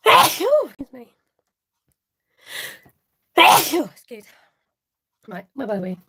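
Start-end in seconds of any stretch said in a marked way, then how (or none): 0.1-4.3 sneezing. Real, not acted. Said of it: "I'm gonna sneeze".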